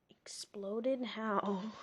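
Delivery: puzzled whispered aside. Real, not acted. Reader wondering aloud to the listener, whispered. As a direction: breathe the word, baffled.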